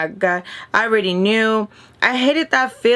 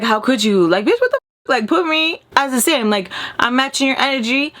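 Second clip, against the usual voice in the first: angry; 4 semitones higher than usual. Retold or imitated. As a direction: vent at double standards. Retold angry outburst at hypocrites.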